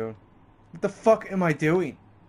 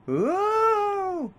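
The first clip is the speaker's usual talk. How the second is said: long, high-pitched "ooh"; high drawn-out ooh. Real, not acted. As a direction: let out a soaring, sustained ooh. Distracted reaction while playing.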